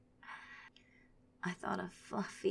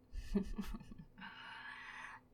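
soft sinister laugh; quiet cruel giggle; evil chuckle; 6 dB quieter than usual. Acted. Villain laughs at captive.

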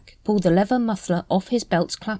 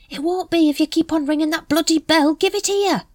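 irritated; 12 semitones higher, 4 dB louder than usual. A narrator's reading. Nobby scolds Carrot.

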